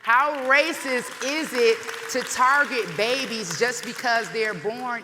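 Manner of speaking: loud, slow, ringing proclamation; preaching at full force. Real, not acted. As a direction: preach it to the back row. Rally speech at full force.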